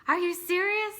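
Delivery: high, loud squeal of disbelief; shrieking mock disbelief at the news of twins; stunned disbelief. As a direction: replay the shocked "are you serious" on the ultrasound. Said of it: "four seconds of me going".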